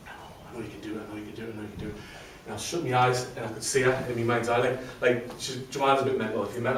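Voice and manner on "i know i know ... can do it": hushed, gentle voice of a supportive friend; quiet repeated reassurance from his wife; soft imagined encouragement. Retold or imitated. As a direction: murmur it like a voice in your head. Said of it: "thinking of Joanne's voice in my head".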